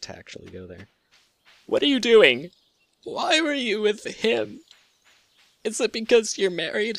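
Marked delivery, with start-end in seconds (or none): 1.7-7.0 high, wounded, accusing lover; jealous lover voice. Pretend. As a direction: play a jealous partner confronting a cheater.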